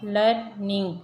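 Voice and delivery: Learning broken as लर, निंग; split into syllables. Shown as a demonstration. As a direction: say learning in two separate beats. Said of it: "हिस्सों में पढ़ते हैं".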